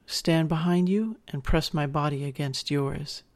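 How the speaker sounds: plain reading voice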